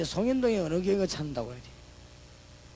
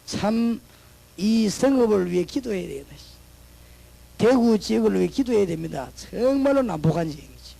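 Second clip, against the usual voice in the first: louder exhortation to pray for the city; preaching; 3 semitones higher, 6 dB louder than usual. Acted. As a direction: urge the congregation to pray, firmly. Returns to preaching. (강하게 권면하는 설교)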